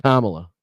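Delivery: Kamala in a low, falling KAH-muh-luh; correct pronunciation. Shown as a demonstration. Host repeating the right name, emphatic first-syllable stress. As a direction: say Kamala as COMMA-la, with finality. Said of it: "That's how you fucking say her name".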